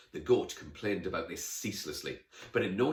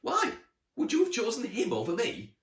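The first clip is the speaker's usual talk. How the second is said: high, loud, affronted question; jealous challenge; 6 dB louder than usual. Acted. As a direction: demand it, miffed at being second choice. Jealous goat challenges Emily.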